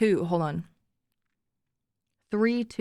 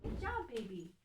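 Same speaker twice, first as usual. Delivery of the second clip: higher and much softer, tender to the dog; 7 semitones higher, 14 dB quieter than usual. Real, not acted. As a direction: coo quietly to the puppy. Praising her dog softly.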